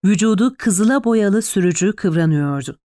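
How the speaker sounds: ordinary delivery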